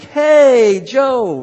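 loud, high call across the crowd. Pretend. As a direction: shout hello to a friend passing by. Calling to a friend at a parade.

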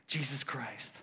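ordinary talk, nothing marked

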